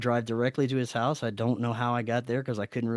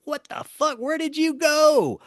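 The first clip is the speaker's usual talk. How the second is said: high, loud, frantic yell, slowing on the second question; imitating a panicked wife; worried shout; 12 semitones higher, 3 dB louder than usual. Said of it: "they're worried as shit".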